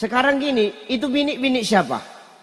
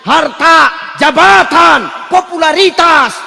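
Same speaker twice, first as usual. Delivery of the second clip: louder and higher, slow on jabatan; loud, higher preaching shout listing harta, jabatan, popularitas; 5 semitones higher, 8 dB louder, slower than usual. Real, not acted. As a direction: hammer each word to the crowd. Preacher calling out worldly things.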